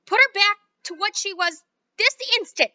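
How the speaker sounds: high, loud demand; mother demands Wonka fix her; furious mother. Acted.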